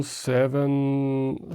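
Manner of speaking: usual speaking voice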